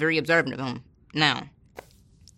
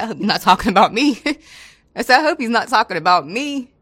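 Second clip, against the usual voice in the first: nervous laughing exclaim; 6 semitones higher, 7 dB louder than usual. Retold or imitated. Nervous joke about inmate's threat.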